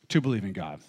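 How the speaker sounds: ordinary talk, nothing marked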